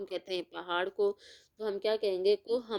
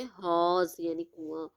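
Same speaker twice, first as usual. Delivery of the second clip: ordinary delivery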